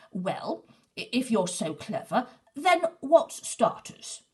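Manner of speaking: loud, slow blustering demand; blustering wolf. A narrator's reading. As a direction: bluster to cover ignorance. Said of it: "blustered Big Wolf".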